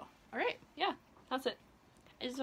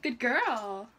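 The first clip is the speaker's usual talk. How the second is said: praising pet; 6 dB louder than usual. Real, not acted. Praising cat.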